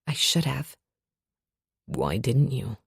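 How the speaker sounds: deep, quiet question; soft brooding man; low male voice. A narrator's reading.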